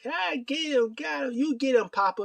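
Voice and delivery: very high, squawky mock egging-on; mock cheering on. Imitating Danielson egging Regal on, high mock cheer. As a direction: imitate an over-excited sidekick in a high voice.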